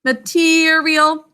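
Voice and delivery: "Material" slowly as muh-TEEE-ree-ul; "Material" slow, with a long TEE; syllables stretched out. Shown as a demonstration. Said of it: "Thinking cap on that".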